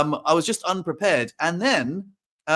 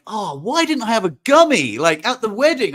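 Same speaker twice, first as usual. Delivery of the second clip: higher and louder, bursting with delight; excited realisation; 6 semitones higher, 5 dB louder than usual. Retold or imitated. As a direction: gush about feeling great. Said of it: "And then I was like".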